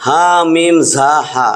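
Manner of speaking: হা মিম জা হা spelled slowly, letter by letter; slow letter-by-letter spelling. Shown as a demonstration. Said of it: "হামজা উচ্চারণটা হবে এরকম।".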